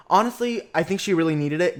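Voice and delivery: ordinary talk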